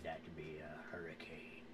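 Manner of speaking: put-on low, quiet old sea dog; old sailor. GM voices old sailor cook.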